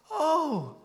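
high, delighted discovery; the penny drops for a small boy; child's realisation. Retold or imitated. Little son finally understanding, high and delighted.

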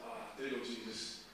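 low, very soft prayer; praying to himself. Retold or imitated. Man on a falling plane prays to himself, quietly. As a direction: murmur it privately to God. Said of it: "just to myself".

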